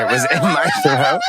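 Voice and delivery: breaking into a high laugh mid-word; laughing through it. Retold or imitated. Telling of choking on chocolate while singing, laughing.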